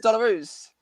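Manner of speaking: put-on Australian accent. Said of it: "that's my Australian accent for you there".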